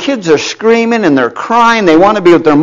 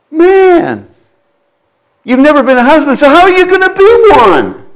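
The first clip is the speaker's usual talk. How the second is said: mock incredulous; 9 semitones higher than usual. Voicing mock challenge to husband.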